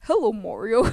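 loud cartoon reply; put-on loud comic greeting; Luigi meme voice. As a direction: imitate a goofy meme character answering loudly. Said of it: "puis là, Luigi, il fait".

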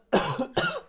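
sharp cough to clear throat. Real, not acted. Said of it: "j'ai quelque chose dans la gorge".

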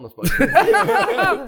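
high laughing squeal. Real, not acted.

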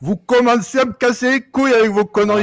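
loud, high-pitched rant; irritated outburst. Acted. Guest tells off the hosts for interrupting, angry outburst. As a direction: blow up at the interruption.

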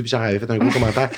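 ordinary talk

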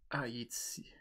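very soft, voiceless aside; near whisper. Real, not acted. Co-host finding the monument on a map, whispering to self. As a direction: murmur it to yourself.

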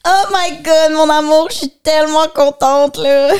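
gushing bride. Acted. Bride gushing to her new husband, loud and high. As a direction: gush like an overjoyed newlywed.

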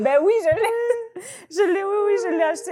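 high, loud laughing cry; laughing squeal. Real, not acted. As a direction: burst out laughing in recognition. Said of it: "recognition, laughing". (cri de rire en se rappelant)

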